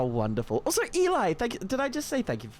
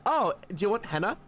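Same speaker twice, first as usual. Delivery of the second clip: lightly voiced higher, quick offer; henna lady's offer; 7 semitones higher, faster than usual. Henna lady offering to streamer, lightly imitated. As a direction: imitate a friendly vendor, a little higher. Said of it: "The lady just said".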